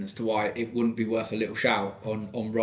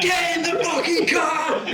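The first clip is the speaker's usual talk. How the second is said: furious yell, much higher and louder; angry yell; 4 dB louder, slower than usual. Retold or imitated. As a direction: blow up at the kids in the car. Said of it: "scared of me when I blow".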